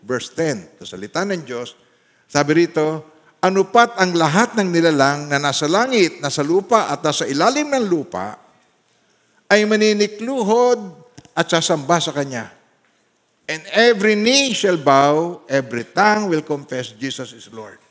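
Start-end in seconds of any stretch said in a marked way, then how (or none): 3.4-12.5 louder, measured reading of a Bible verse; scripture reading. Acted. Preacher to congregation, reading the verse with weight. 13.5-17.8 slow, loud, deliberate reading of the verse; slow scripture reading. Acted. Preacher to congregation, reading the verse slowly and loudly.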